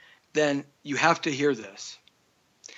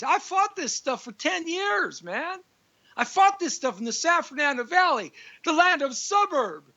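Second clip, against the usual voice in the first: much higher, worked-up outcry at his fight; heated rant; 9 semitones higher than usual. Real, not acted. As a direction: pitch jumps up as he vents about ten years fighting this. Ranting about city crime.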